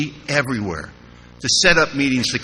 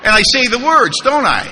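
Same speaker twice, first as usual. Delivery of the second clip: ordinary talk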